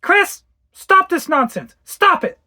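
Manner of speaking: sharp, high shout, slow; angry mother. Acted. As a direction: cut the tantrum off hard. Mother snaps at crying child.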